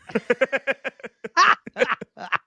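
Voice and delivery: high, loud burst of laughter; real laughter. Real, not acted. Host laughing at the Ocean's joke, high hearty laugh.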